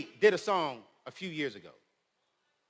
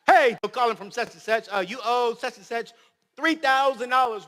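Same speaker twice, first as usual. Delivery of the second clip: pushy debt collector; 5 semitones higher, 7 dB louder than usual. Retold or imitated. Acting debt collector on phone.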